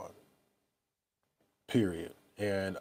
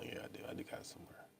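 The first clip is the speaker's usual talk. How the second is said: whispered to self while searching; breathy under-the-breath whisper; 22 dB quieter, much faster than usual. Real, not acted. Searching for the clip on camera, whispering to himself.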